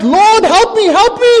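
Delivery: high, loud cry for help; desperate cry. Acted. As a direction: scream for rescue as you go under. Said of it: "He cried out".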